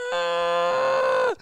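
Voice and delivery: high drawn-out scream; imitated beach scream, long and high. Crowd waking him in terror, screaming. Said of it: "Screams, screams".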